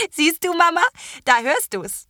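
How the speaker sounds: put-on high, loud girl's teasing; teasing young witch. A narrator's reading. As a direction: needle her mum playfully. Terra teasing her mother.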